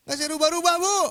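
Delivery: loud, high comic protest; membantah guru. Retold or imitated. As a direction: insist loudly to the teacher, hammed-up. Murid membantah guru yang marah. (membantah dengan suara keras)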